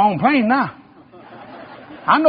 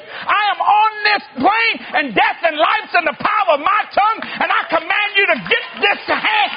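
much higher, shouted preaching rebuke; fiery shouted prayer; commanding rebuke; 13 semitones higher than usual. Acted. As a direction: bind the devil with full force. Rebuking devil at full force.

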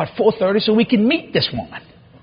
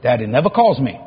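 normal talking voice

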